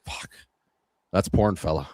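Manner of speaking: low, dry delivery with a chuckle; deadpan buddy line. Retold or imitated. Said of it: "just saying".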